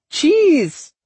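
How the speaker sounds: loud, very high squeal of delight; excited cry. A narrator's reading. Sudden delighted cry at cheese kiosk.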